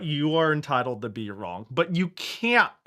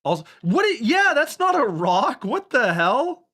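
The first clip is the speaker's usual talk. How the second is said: much higher and louder, incredulous; shocked outburst; 10 semitones higher, 6 dB louder than usual. Real, not acted. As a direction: shout in sheer disbelief. Shocked at the rock sprite.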